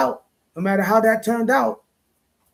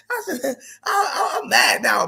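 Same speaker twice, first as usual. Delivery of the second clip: laughing, worked up; 6 semitones higher, slower than usual. Real, not acted. Laughing while getting worked up.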